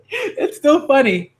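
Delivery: high, slow words through giggles; real laughing. Real, not acted. Reader laughs at an old joke, helpless giggling. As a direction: crack up while talking.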